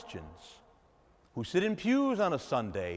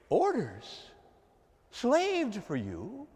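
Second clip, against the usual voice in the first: incredulous echo; 6 semitones higher, much slower than usual. Shown as a demonstration. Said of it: "Does this sound like a son".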